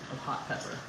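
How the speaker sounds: ordinary talk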